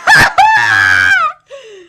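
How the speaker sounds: a loud, high burst of laughter. Real, not acted. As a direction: let out a big shouted laugh.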